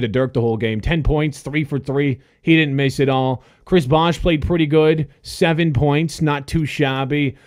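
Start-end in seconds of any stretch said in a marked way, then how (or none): none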